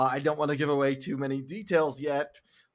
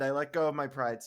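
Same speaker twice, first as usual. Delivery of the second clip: usual speaking voice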